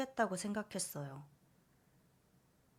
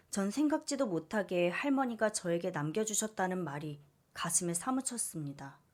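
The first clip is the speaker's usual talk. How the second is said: usual speaking voice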